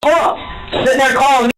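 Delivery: furious, high-pitched berating; angry shouting. Real, not acted. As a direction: tear into the suspect at full pitch. Detective berating suspect in quarrel.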